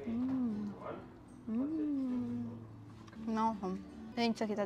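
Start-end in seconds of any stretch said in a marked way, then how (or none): none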